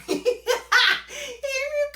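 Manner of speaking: cackling loud and high at the memory; burst of laughter. Real, not acted. To the viewers about her crying ex, a loud high laugh.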